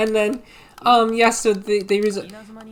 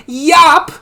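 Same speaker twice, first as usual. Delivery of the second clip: high, loud mock yell; one sudden acted shout; retold yell; 6 semitones higher, 8 dB louder than usual. Jojo saves the town with his yell, mock shout. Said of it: "He yells".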